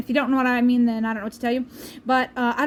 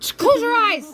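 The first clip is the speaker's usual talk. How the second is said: higher, louder sudden order; loud command; 10 semitones higher, 5 dB louder than usual. Real, not acted. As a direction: snap at him to shut his eyes. Husband peeking during taste test.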